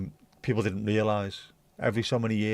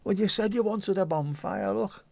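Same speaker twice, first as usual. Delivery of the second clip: mock telling-off; 8 semitones higher than usual. Mock reply about the bonfire.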